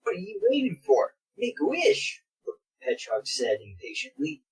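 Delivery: normal talking voice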